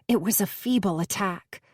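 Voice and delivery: ordinary delivery, nothing marked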